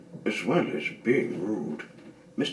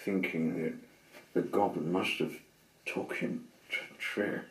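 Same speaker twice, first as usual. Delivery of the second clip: ordinary delivery, nothing marked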